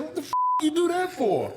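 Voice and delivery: high, startled outcry; shocked outburst. Retold or imitated. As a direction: yelp it out in disbelief after the hit. Nose busted by trainer.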